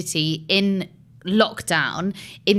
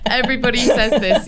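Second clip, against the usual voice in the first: laughing; 6 semitones higher, 5 dB louder, faster than usual. Real, not acted. Laughing protest.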